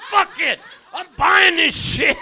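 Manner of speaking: loud, drawn-out reckless declaration; reckless shout. Retold or imitated. Man with six months to live, splurging: reckless shout. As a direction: shout it like throwing caution away.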